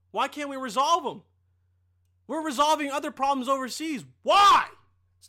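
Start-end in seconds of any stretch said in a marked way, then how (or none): 4.2-4.6 a loud, higher cry of why; exasperated shout. Real, not acted. Ranting about helping Mexico, a loud frustrated why. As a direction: throw the question out in frustration.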